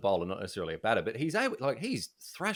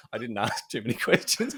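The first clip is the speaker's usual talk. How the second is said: ordinary talk, nothing marked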